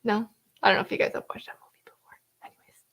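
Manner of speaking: hushed fast whisper; whispering on the phone. Real, not acted. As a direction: whisper it quickly and softly. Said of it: "Why are you whispering?".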